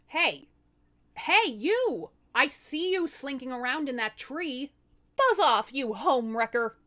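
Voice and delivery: loud angry shouts up into a tree; yelling. Acted. Wife shouting at the demon in the tree, loud and angry. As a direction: yell at the lurker to buzz off.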